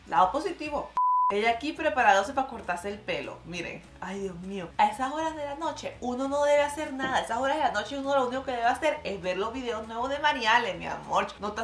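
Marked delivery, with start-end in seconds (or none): none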